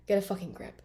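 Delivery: ordinary talk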